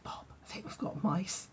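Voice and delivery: hushed whisper to wake her husband. Retold or imitated. Mum whispering to dad in the dark about mice. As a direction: breathe it so nobody else wakes.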